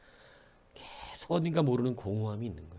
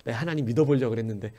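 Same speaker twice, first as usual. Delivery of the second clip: usual speaking voice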